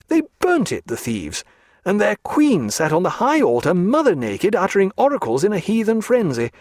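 high, swooping outrage without shouting; indignant tirade. A narrator's reading. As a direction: rail at the culprits, pitch leaping on key words. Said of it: "glaring angrily at Elsinore".

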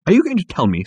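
high, loud questioning; indignant challenge. Acted. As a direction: confront it in disbelief. Arthur indignantly challenges the animal.

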